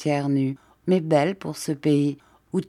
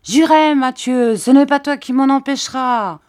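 much higher, loud defiant shout; defiant yell; 8 semitones higher, 7 dB louder than usual. A narrator's reading. Jean yelling defiance at the armed Mathieu. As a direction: hurl the defiance back at him. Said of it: "Jean se mit à crier".

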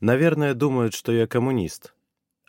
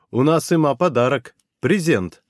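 louder, higher proud announcement; solemn announcement; 4 semitones higher, 4 dB louder than usual. Acted. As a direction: announce the gift with ceremony. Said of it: "объявил я и торжественно поставил".

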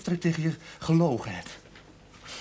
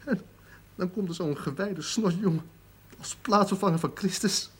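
usual speaking voice

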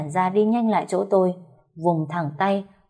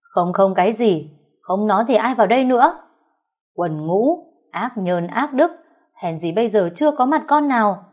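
louder, higher furious scolding; furious shout; 3 semitones higher, 4 dB louder than usual. Acted. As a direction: shout her down in rage. Mother-in-law shouting accusations. (mẹ chồng quát tháo giận dữ)

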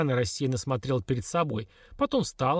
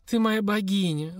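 slow, higher adoring plea; imploring; 7 semitones higher, slower than usual. A narrator's reading. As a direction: beg her like a worshipper. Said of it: "взывала Светлана Петровна". (хозяйка медленно и высоко взывает к Лильке)

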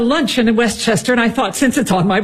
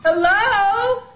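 calling out; 12 semitones higher, much slower than usual. Acted. Mother calls up the stairs.

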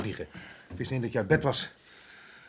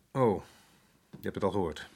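normal talking voice

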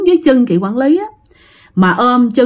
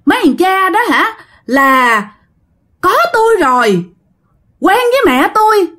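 higher, harsh ghostly scolding; fierce scolding; 7 semitones higher than usual. Retold or imitated. Dead girl yelling at the staring men, fierce. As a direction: snap at the nosy men. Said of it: "cái mặt hung dữ lên".

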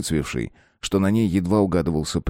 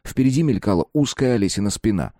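ordinary delivery, nothing marked

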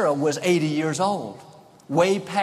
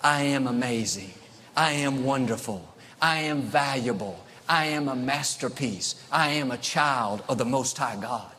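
lower, steady and sure; bold quiet affirmation; 4 semitones lower, 3 dB quieter than usual. Shown as a demonstration. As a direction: speak it like a calm vow. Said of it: "be bold like David to say".

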